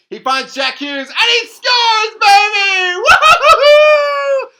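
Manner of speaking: screaming with joy, high and loud; shrieking jackpot winner. Real, not acted. Celebrating the golden goal.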